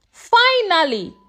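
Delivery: ordinary delivery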